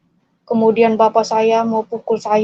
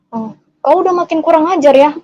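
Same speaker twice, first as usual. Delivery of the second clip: higher-pitched scolding; imitated angry father; 5 semitones higher, 4 dB louder than usual. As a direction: act out an indignant dad's reproach.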